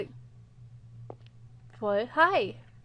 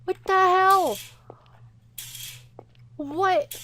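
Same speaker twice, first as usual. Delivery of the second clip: loud, higher cry of disbelief; shocked disbelief; 6 semitones higher, 9 dB louder, slower than usual. Real, not acted. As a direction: exclaim in real shock. Horrified at the dead cat.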